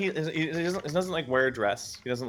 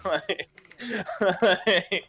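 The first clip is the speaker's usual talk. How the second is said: laughing; 7 semitones higher than usual. Real, not acted. Laughing at his own story.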